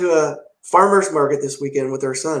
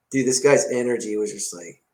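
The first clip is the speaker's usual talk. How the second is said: ordinary talk, nothing marked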